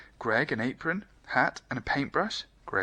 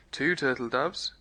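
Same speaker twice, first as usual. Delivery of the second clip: ordinary delivery, nothing marked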